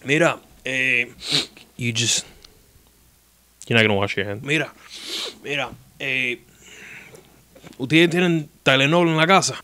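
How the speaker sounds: lightly voiced old Cuban doctor. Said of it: "he would be like".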